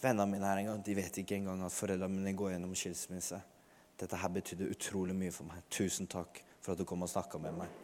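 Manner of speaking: put-on softly retelling a moved young man's thanks; grateful stranger, quiet. Young man thanks the stranger who prayed, hushed and moved. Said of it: "han sier til og med".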